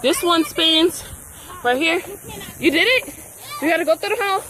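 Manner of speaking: usual speaking voice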